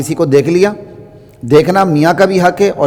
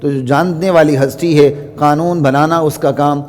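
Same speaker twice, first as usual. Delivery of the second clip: ordinary talk, nothing marked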